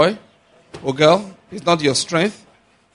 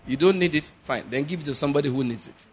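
ordinary talk

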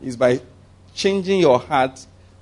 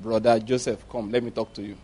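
gentle angel; 6 semitones lower, 7 dB quieter than usual. Acted. Angel quietly calling Joseph aside, lower and soft. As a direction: lower and much softer, gentle. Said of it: "had to send an angel".

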